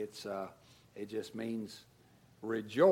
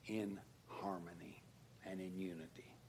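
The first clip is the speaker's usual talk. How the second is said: whispered; 6 semitones lower, 16 dB quieter than usual. Acted. Tender close on unity.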